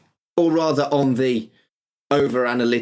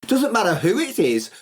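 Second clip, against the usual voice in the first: normal talking voice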